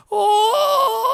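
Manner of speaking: sudden high yelp of pain; pained cry. Acted. A giant yelps in pain as his knee snaps, loud high cry. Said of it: "He goes".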